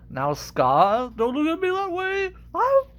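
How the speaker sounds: hammed-up fright, pitch shooting up; acted alarm. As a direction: voice climbing to a high squeal. Acts threatened Zazu facing Scar.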